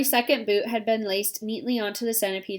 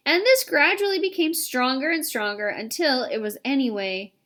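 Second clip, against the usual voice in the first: usual speaking voice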